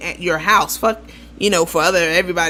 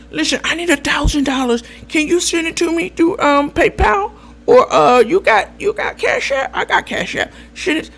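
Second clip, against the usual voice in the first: high-pitched imitation of a pushy borrower; pushy money request; 7 semitones higher than usual. Old acquaintance asking for a thousand dollars, pushy. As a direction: press for cash in a raised pitch. Acted plea for money on phone.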